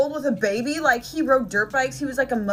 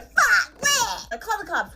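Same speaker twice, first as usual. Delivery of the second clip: imitating the panicked mom; 9 semitones higher, slower than usual. Mom's panicked retold reaction.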